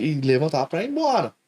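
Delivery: ordinary delivery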